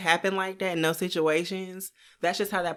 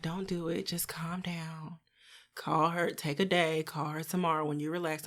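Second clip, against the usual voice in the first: soft calm mom voice; 8 dB quieter than usual. Retold or imitated. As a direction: breathe the advice out in a gentle whisper. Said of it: "She talked like this".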